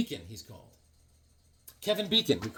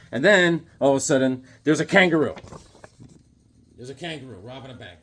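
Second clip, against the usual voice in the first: ordinary talk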